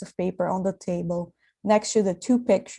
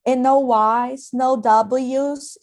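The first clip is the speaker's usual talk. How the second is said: exhausted and bitter; 5 semitones higher, 5 dB louder, much slower than usual. A narrator's reading. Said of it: "as if exhausted and bitter".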